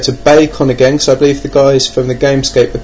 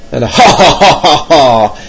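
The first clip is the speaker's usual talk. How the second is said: villainous laugh; 3 semitones higher, 4 dB louder than usual. Pretend. Villain laugh for gentleman thief.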